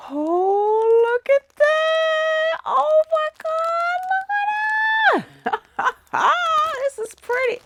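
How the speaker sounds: very high, loud gushing squeal; excited squeal. Real, not acted. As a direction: shriek with delight at the yarn. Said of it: "I can't contain myself".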